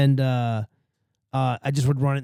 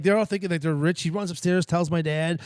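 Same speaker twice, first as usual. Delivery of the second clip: ordinary talk, nothing marked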